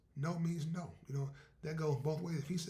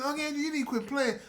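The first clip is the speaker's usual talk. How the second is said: higher, teasing niece; 11 semitones higher, 7 dB louder than usual. Acted. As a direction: scold him playfully like a girl. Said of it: "She said".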